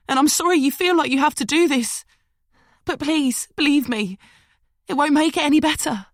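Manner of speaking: high, loud pleading London woman, rushing her words; begging her captor to believe her, fast; frightened woman pleading. Acted. Captive woman begging the knife-wielding Liam, high and urgent.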